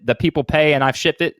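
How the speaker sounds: usual speaking voice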